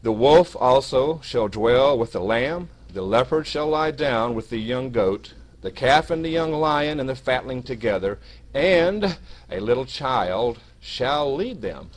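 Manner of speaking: slow, emphatic, loud Bible reading; scripture reading. Acted. Preacher reads Isaiah's prophecy to brethren, emphatic scripture reading. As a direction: read it out deliberately, stretching key words.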